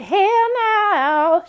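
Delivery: high, sharp diva dismissal; sassy refusal. Retold or imitated. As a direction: toss it off with full attitude. Sassy imagined reply from character.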